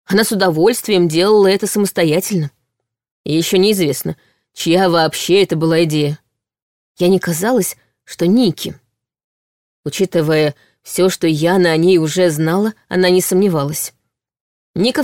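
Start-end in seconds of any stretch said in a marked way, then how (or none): none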